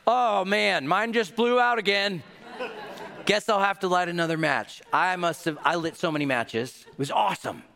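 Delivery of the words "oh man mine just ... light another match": mock innocent excuse. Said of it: "I was like".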